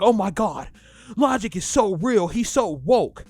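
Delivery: loud, starry-eyed fan voice, hammed-up; mock gushing fan. Mocking gushing fans.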